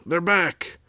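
high, urgent story-character cry; sudden excited yell while reading aloud; shouted warning. A narrator's reading.

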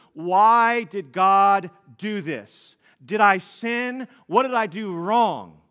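put-on loud, anguished cry of a frightened child. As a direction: cry it out like a hurt little girl asking why. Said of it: "she just screams out to us".

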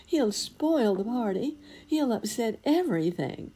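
high, anxious fretting voice; party guests fretting about the giant, high and alarmed. Acted. Guests fear the angry giant.

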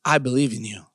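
low, softened coach quote; imitated coach. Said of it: "heard a grown man tell me".

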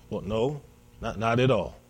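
low, soft, hesitant denial; meek reply. Acted. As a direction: stammer it quietly, like someone cornered. Disciple answers Jesus's questioning.

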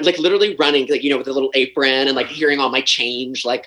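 usual speaking voice

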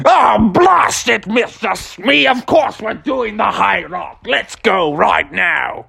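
slow, drawn-out pirate captain; blustering Captain Hook. Acted. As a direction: growl at the first mate like a pantomime pirate.

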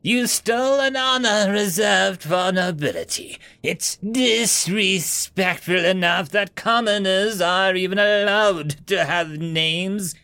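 high, slow, sneering noble voice; haughty noble. Acted. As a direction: scorn a lowly commoner. Haughty noble scolds commoner.

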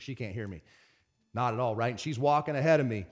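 normal talking voice

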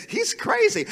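high-pitched mock incredulity; mock disbelief. Disciples reacting to Jesus going now, high-pitched disbelief. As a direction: voice jumps right up, as if baffled.

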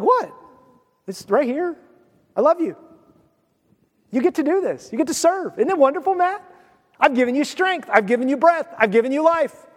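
high-pitched, lightly pleading voice; God. Acted. God coaxes a reluctant man to serve, high and coaxing. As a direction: coax gently with mock innocence. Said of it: "God's like".

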